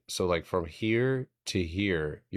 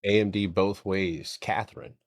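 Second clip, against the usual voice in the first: ordinary talk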